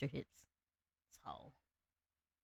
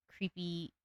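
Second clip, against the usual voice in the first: usual speaking voice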